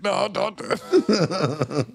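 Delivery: slow hushed plea. Retold or imitated. Randy voiced pleading.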